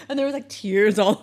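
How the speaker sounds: usual speaking voice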